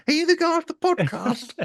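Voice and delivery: very high squeaky fan voice; a stranger spotting him in the street, squeaky and excited; mock fan voice. Fans imitated in squeaky voice.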